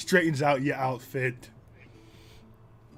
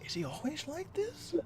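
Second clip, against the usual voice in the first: very high, quieter questioning voice; parent's hushed aside; 6 dB quieter than usual. Acted. Father asking the friends about his son, hushed aside.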